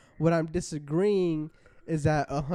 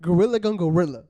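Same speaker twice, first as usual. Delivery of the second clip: emphatic; 8 dB louder, faster than usual. Real, not acted. Arguing gorilla fight.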